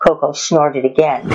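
plain reading voice

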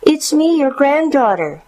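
put-on high, sing-out child's voice; little girl. A narrator's reading. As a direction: answer as a small granddaughter at the door. Girl answers grandmother through the door.

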